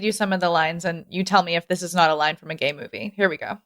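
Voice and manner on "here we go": normal talking voice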